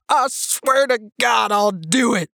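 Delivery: high, drawn-out panicky threat; showy fear. A narrator's reading. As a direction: swear it like a hammed-up stock coward. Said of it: "his eyes wide with showy fear".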